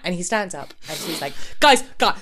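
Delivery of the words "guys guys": urgent, louder imitation of a man taking the floor; mock manic bid for the room; manic appeal. Tom stands up to address the room, manic. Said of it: "one of the most manic".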